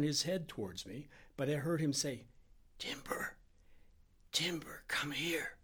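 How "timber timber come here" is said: strained, hushed calling of a friend over; careful whisper. A narrator's reading. Sick Harry summons his friend from the bed, careful whisper. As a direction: whisper each word separately and slowly. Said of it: "whispering each word carefully".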